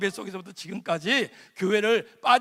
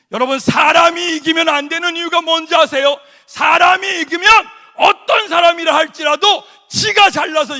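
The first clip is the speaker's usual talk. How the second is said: loud fervent sermon delivery; preaching at full force; 4 semitones higher, 11 dB louder than usual. Acted. (힘차게 외치는 설교)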